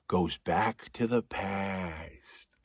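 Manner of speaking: slow, low whisper; a slow, eerie whispered goes back to the past; spooky whisper. Acted. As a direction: hush it like a ghost story. Spooky repeated narration, whispered.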